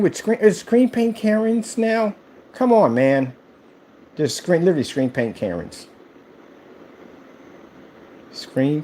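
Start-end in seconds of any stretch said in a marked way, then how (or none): none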